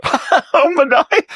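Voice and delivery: high, slow, loud fake laugh on the name; hammed-up giggly Mamdani, dragged out. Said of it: "Laugh and kid around with Mamdani".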